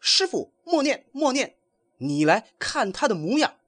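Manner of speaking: high, urgent cry to the master; loud call. A narrator's reading. Wukong urgently stops his master from chanting, loud and high. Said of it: "大声说".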